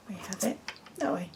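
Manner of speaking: fast soft whispered aside; whispered take. Shown as a demonstration. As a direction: drop to a quick whisper. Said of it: "so you either have it".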